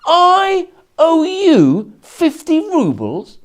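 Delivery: high, loud and slow "I owe you fifty rubles?"; drawn-out astonished question. Acted. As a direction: stretch each word in mock disbelief. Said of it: "now getting exasperated".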